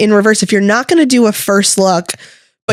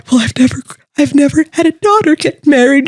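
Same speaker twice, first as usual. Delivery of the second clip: emotional dad; 7 semitones higher, faster than usual. Retold or imitated. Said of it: "he's like".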